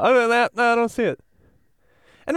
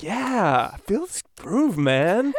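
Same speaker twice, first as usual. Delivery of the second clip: jazz hipster; 2 semitones higher, much slower than usual. Retold or imitated. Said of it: "walking down the street going".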